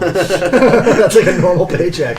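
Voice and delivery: loud slow laughing words. Real, not acted. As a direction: laugh through the line. Laughs at the offer.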